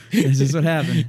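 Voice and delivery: laughing through the words. Real, not acted. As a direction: giggle it out. Laughing repeat of joke.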